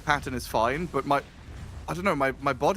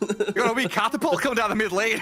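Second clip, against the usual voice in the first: light mock Scottish accent, a bit higher and laughing; hammed-up Scottish accent; a touch of Scottish accent for the caster banter; 5 semitones higher than usual. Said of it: "would you like a Scottish accent instead".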